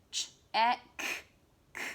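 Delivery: ch, eh, k said apart with gaps; word stretched into sounds. Shown as a demonstration. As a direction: sound out each part of check. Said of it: "we stretched out the word check".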